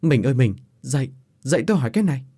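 nói nhanh, dồn dập gọi vợ dậy; đánh thức vợ gấp gáp; gọi vợ vội vàng. Acted. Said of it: "chạy vào buồng gọi vợ". (chồng gấp gáp đánh thức vợ giữa đêm, nói nhanh)

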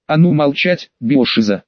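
ordinary delivery

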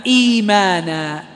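إيمانا said slowly, the إي held long; teacher models the lengthened إيمانا; madd demo. Shown as a demonstration. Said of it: "فالذي يناسبها الياء".

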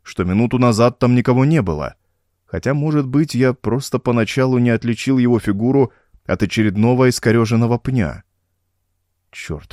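usual speaking voice

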